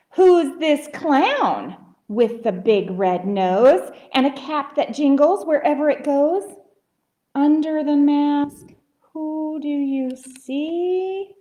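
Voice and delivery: lilting read slowing to drawn-out sing-song; rhyming story reading. A narrator's reading. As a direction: pose a riddle to children, stretching the ending. Reads rhyming story to children.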